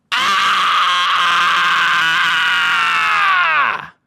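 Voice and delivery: loud sustained "Ah!" scream; sudden long scream. Shown as a demonstration. Streamer demonstrating his absurd advice on a coworker, long sudden scream. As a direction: scream it out at full force and hold it.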